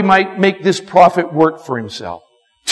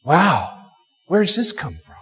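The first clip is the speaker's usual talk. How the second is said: hushed wonder; 5 semitones lower, 7 dB quieter than usual. Pretend. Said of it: "what if this guy had said".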